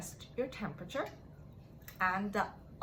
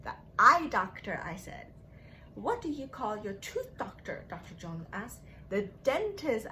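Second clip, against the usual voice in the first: storybook read-aloud with voiced quotes; gentle picture-book narration; reading to children; 4 dB quieter than usual. A narrator's reading. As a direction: read it like story time on the rug. Teacher reads picture book aloud.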